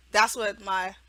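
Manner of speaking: ordinary talk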